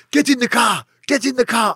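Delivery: high, loud barked order; loud, high gunpoint order; gunman's shout. Retold or imitated. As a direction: bark it like a gunman.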